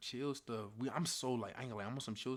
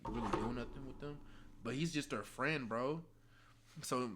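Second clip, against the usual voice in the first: ordinary talk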